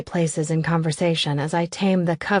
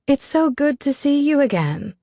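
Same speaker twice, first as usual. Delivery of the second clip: much higher, bright-toned friend's voice; perky Davina; 8 semitones higher, 3 dB louder than usual. A narrator's reading. Davina greeting Elizabeth, high and gushing. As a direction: gush the greeting, over-friendly.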